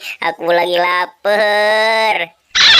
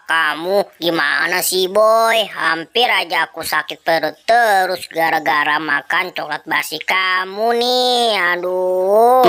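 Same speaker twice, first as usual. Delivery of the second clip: ordinary talk, nothing marked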